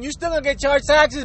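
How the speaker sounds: usual speaking voice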